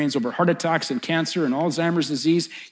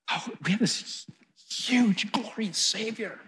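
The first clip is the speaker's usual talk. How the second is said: higher, softer, lingering wonder; hushed awe; 7 semitones higher, 6 dB quieter, slower than usual. Real, not acted. Preacher marvelling at the Savior, hushed awe. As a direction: marvel softly, drawing out the praise.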